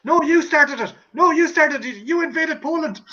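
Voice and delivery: high-pitched comic indignation; mock indignant quarrel. Acting out Fawlty Towers quarrel.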